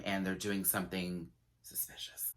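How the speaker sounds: conspiratorial whisper. Real, not acted. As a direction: drop to a secret whisper.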